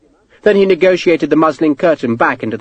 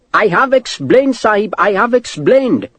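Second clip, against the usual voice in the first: high, slow and insistent clerk; exasperated clerk; 9 semitones higher than usual. Acted. Clerk to insistent officer, exasperated. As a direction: plead it slowly in a high, accented voice.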